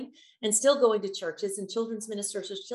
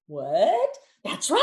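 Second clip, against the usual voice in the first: mock surprise; 3 semitones higher, 6 dB louder, much slower than usual.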